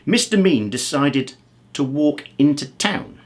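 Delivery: ordinary delivery, nothing marked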